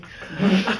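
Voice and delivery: short loud laugh; real laughter. Real, not acted. Apologizing for laughing before serious advice, loud laugh. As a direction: burst out in a brief laugh. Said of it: "I don't know why I'm laughing".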